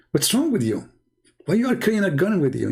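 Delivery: lightly imitated teasing, a bit fast; mocking. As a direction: poke fun at the man carrying a gun. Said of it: "it's like making fun".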